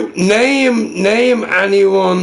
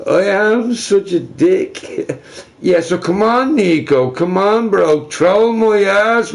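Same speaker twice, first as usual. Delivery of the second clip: ordinary delivery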